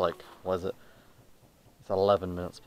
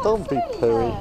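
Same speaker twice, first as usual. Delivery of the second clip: high coaxing voice; 5 dB louder, much slower than usual. Real, not acted. Coaxing a grumpy child.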